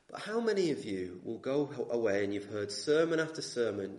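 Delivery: normal talking voice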